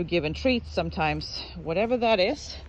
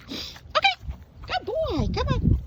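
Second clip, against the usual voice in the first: very high coaxing calls, some softer; coaxing the dog; 4 dB quieter than usual. Real, not acted. Trainer coaxing the dog to come, very high coaxing. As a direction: coax him along in a squeaky voice.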